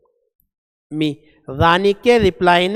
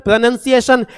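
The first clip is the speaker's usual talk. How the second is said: ordinary talk, nothing marked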